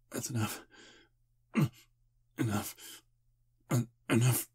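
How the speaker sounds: from firm to sharp loud command; rising, insistent enough; building command. Acted. Stopping her drinking.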